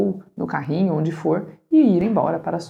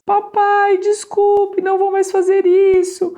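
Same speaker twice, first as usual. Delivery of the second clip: put-on high, loud pleading child's voice, near tears; crying child; 13 semitones higher, 4 dB louder than usual. Son begs his father to stay at the park, high tearful pleading. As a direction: beg like a sobbing little boy. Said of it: "desfaz-se imediatamente em lágrimas".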